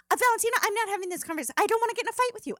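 high, fast, exasperated mom; frustrated mom. Retold or imitated. Mom shutting down her daughter, fast and exasperated. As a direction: rush it out, fed up. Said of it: "she'll just get really frustrated".

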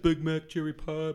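Eddie Murphy. Retold or imitated.

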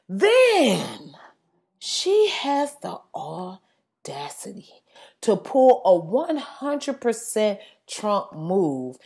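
slow, whispered outrage; hushed disbelief. Real, not acted. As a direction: whisper it slowly, spacing out the words. Incredulous build-up to her complaint.

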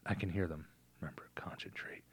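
urgent whisper. Retold or imitated. Father whispering instructions to his daughter.

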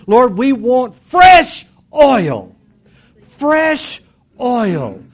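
loud, high, impassioned plea to God; fervent preaching prayer. Real, not acted. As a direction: cry it out to the whole church, drawing out key words. Fervent congregational prayer at full force.

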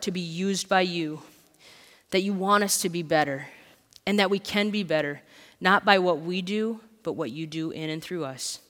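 soft, earnest prayer voice. Acted. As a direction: pray quietly to God for the church. Pastor leads a prayer.

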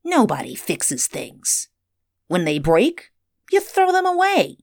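put-on sneering boy, climbing high at the end. A narrator's reading. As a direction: scoff like a scornful kid. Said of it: "Earl sneered".

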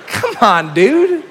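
high, loud, disbelieving dude; a high, loud, laughing dude; exasperated dude. Real, not acted. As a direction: call it out in amused disbelief. Mock scolding the son.

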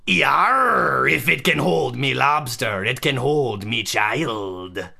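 put-on hammy pirate accent; gruff old sea dog pirate accent. Pirate lobsterman testimonial.

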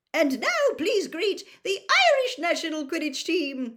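high, loud booming announcer; announcer yell. A narrator's reading. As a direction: bellow to the whole stadium. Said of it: "yelled Bagman".

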